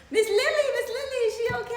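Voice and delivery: high, loud, anxious calling through a door; worried teacher. Retold or imitated. Teacher knocking on the bathroom door, calling for the mother in a high worried voice. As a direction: call out high, fretting over the child.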